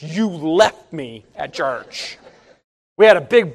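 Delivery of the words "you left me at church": son's complaint. Retold or imitated. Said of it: "Kyle still reminds us".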